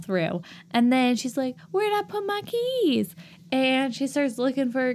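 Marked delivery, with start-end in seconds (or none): 1.7-3.1 high-pitched Southern belle; Southern accent. Acted. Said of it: "Why does everyone have a Southern accent".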